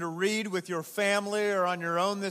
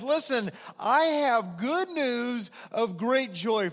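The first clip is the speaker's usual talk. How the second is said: joyful angel; 5 semitones higher, 4 dB louder, slower than usual. Acted. Preacher voicing the angel.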